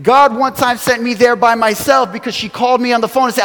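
ordinary delivery

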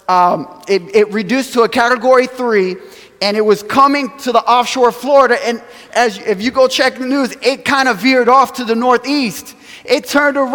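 ordinary delivery

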